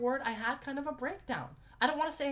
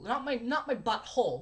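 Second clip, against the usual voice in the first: ordinary talk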